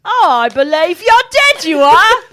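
high, loud, slow mock British; hammed-up cockney ghost; loud mock British taunt; British accent. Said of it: "weird British accent".

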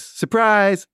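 high, loud jump-out yell; surprise shout. Retold or imitated. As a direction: burst out like a kid springing from hiding. Hidden friend jumps out shouting.

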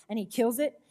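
ordinary delivery

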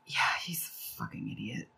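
very low, whispered verdict; near whisper. Real, not acted. Teller passes judgment on the accused, near whisper. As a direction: drop it to a whispered aside.

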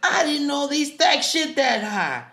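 high, loud, incredulous sergeant yell; hammed-up military grilling; drill sergeant quote. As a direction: bellow at a recruit in disbelief. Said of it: "here we go".

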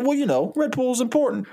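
high, put-on defensive voice; defensive bluster. Donnie defending his energy drink job, high defensive bluster. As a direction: justify it, pitched well up.

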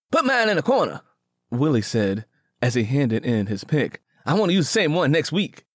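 put-on high young man's voice, quick; Willie's voice. Willie handing his pick to Straw Boss, high quick voice. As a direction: pitch him up and rattle it off.

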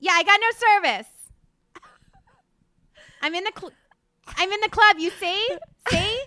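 loud, hammed-up club phone call; dramatic lyric reading. Guest acting out lyrics from the club, loud and hammed-up. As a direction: shout over club noise into a phone, hammed-up. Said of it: "have you read some lyrics of hers".

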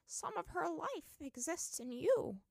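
put-on high, slow, hushed old voice; awed old woman. A narrator's reading. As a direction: reveal it like a mystery. Kana continues speaking in awe.

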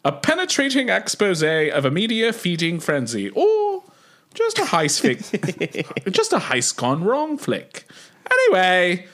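high, hammed-up British accent. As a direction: camp it up like a Paddington villain. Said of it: "I'll read it in my British accent".

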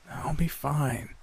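high, soft, slow sickly croak; scratchy voice. A narrator's reading. Sick roommate waving off her friend, scratchy. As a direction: say it faintly through a sore throat. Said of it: "her voice so scratchy it was painful".

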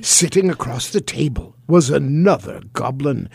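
ordinary delivery, nothing marked

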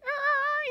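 high mock yelp of a dog; dog's cry imitated high; imitating dog crying. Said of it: "he's crying".

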